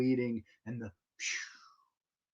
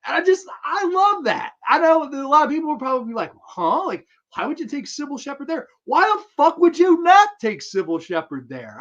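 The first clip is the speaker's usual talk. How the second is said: much higher and louder, fast and worked up; excited rant; 13 semitones higher, 8 dB louder than usual. Real, not acted. Fan defending the movie date, high excited rant. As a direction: get carried away ranting.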